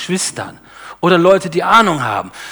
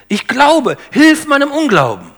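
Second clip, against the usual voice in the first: higher, pleading cry of the verse; raised plea; 8 semitones higher, 4 dB louder than usual. Acted. Preacher voicing the father crying out to Jesus, higher and pleading. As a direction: call it out like a desperate father.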